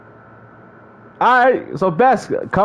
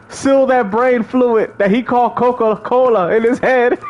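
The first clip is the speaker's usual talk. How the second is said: ordinary talk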